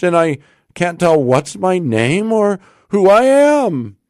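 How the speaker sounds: high, bewildered outpouring; at wit's end. A narrator's reading. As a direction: build to a loud, desperate cry of confusion. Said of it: "at his wit's end".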